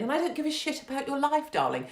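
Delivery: normal talking voice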